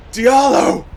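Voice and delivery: high, loud desperate yell. Acted. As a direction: call his name over the wind. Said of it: "I yelled".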